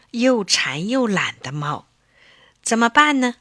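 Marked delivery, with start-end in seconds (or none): none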